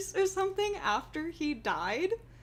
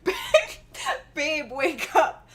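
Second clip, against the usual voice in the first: mock meme wake-up; 3 semitones higher, 7 dB louder than usual. Meme voice, waking partner.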